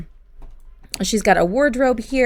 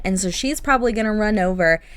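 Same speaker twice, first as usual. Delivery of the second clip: ordinary talk, nothing marked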